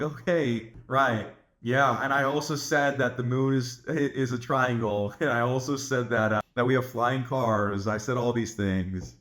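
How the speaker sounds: deadpan, unbothered mock agreement; dry, humoring sarcasm; not taking seriously. Shown as a demonstration. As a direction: agree along with the absurd, slowly. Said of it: "does not take them seriously".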